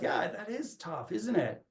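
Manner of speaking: a father calming his upset daughter, soft and slow; gentle empathy. Retold or imitated. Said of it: "I have to be like".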